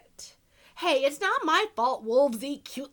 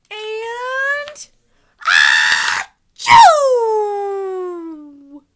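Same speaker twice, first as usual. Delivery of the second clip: loud high achoo; huge sneeze; 6 semitones higher, 16 dB louder, much slower than usual. Acted. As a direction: blast out a giant sneeze. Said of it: "I sneezed a great sneeze".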